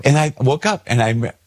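ordinary talk, nothing marked

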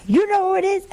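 high, amazed question; astonished wife. Retold or imitated. Wife to the nun, startled and high-pitched. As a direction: she can't believe her ears.

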